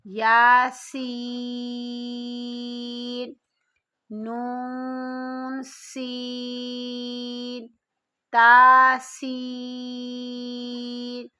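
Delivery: باسین drawn out with a whistling s; slow recited باسین with a hissing سین; whistled seen. Shown as a demonstration. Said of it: "سین سیٹی کی آواز میں پڑھیے".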